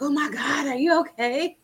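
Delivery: a touch of a panicked mother; worried mom. Retold or imitated. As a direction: play the startled mom seeing her daughter cry. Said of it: "my mom was like".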